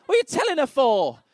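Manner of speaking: high, loud mock shout; outraged patient. Man in waiting room shouting at doctor, outraged. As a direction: yell across the room in comic outrage. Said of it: "And he's out there going".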